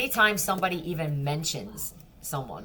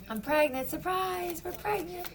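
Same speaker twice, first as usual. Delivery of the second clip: much higher sing-song mock voice; mocking fake announcement; 13 semitones higher, 3 dB quieter than usual. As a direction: parody someone faking happy news.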